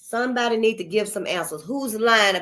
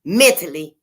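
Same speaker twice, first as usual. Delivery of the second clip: louder on barely hanging on; blunt imagined message; 7 dB louder than usual. Retold or imitated. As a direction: say it the blunt way, rising to loud. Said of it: "Instead of saying".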